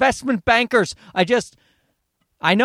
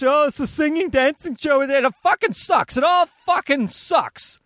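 high, airy mock of a gushing fan; 6 semitones higher than usual. Ranting host parodies talent show fans, mocking gush. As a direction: sneer at the excitement by parroting it.

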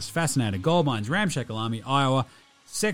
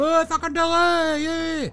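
much higher, slow hooting cheer; mate yelling out; 13 semitones higher, slower than usual. Retold or imitated. Mate hanging out the ute cheering Delhi, hooting.

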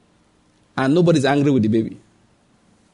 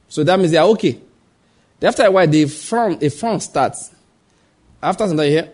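ordinary talk

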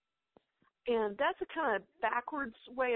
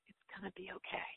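whisper; 3 semitones lower, 9 dB quieter, faster than usual. Real, not acted. Encouraging moms to keep going.

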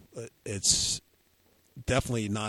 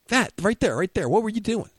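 excited accusing Mike; 9 semitones higher, 5 dB louder than usual. Retold or imitated. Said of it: "So I'm flying and".

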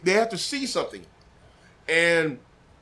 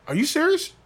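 sudden high, incredulous question; disbelief; 11 semitones higher than usual. Retold or imitated. Telling friend he can't believe the offer, incredulous. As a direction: caught off guard by the offer.

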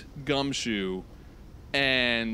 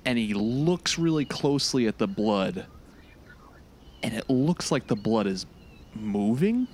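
ordinary talk, nothing marked

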